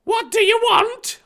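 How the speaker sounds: very high, loud suspicious woman; Aunt Petunia. Acted. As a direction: demand it in a high, hammed-up voice. Aunt Petunia voiced in story.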